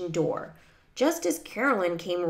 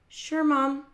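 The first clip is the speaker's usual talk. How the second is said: put-on higher girlish reply; obliging daughter; 7 semitones higher, 3 dB louder than usual. A narrator's reading. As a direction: answer Mom readily in a higher voice. Daughter voiced in story.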